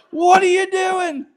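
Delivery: high, slow, loud disbelief; shocked shout. Retold or imitated. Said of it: "They were like".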